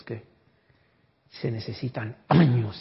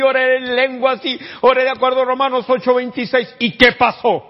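much higher and louder, fast at the start; grieving fury; 10 semitones higher, 8 dB louder than usual. Retold or imitated. Mother tells preacher her son died despite prayer, loud high protest. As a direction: pour out the grief as bitter protest. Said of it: "me dijo".

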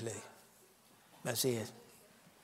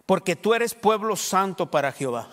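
scripture reading; 4 semitones higher, 6 dB louder than usual. Acted. Preacher reads verse aloud.

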